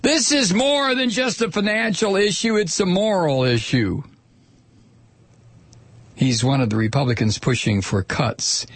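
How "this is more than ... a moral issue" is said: mock senator. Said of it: "He says".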